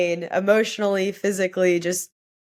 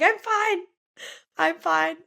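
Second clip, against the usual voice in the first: very high, squeaky retold "crying" voice; tearful insisting; 12 semitones higher, slower than usual. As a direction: imitate insisting through tears, pitched way up. Acting out tearful denial to guards.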